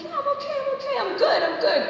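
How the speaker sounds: brushing them off with a bright fake fine; forced cheery reassurance. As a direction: squeak out that you're fine. Brushing off concern as teen.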